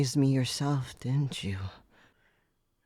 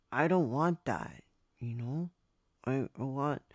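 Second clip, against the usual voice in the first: usual speaking voice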